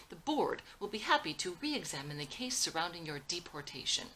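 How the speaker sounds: loud, heated rebuke; angry scolding. Acted. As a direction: scold him hard and loud. Father scolding son in argument.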